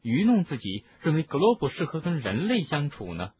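ordinary delivery

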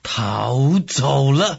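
drawn-out, disbelieving question; incredulous echo. Acted. Sun Quan hearing of the escape, slow incredulous echo. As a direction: stretch the words out in disbelief. Lord echoes shocking news of escape.

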